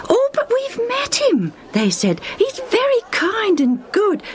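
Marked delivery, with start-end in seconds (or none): 0.1-1.5 very high, eager character voice; persuading friends. A narrator's reading. Friends urge reluctant Lucius to meet Jesus, eagerly. 2.4-4.2 high, eager reassurance; persuading friends. A narrator's reading. Friends assure Lucius about Jesus, eagerly.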